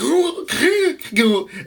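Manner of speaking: a high, wheezy mock gasp for air; asthmatic gasp. Imitating the cousin's asthmatic gasp. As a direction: gasp like a kid who can't breathe. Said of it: "he talked like this".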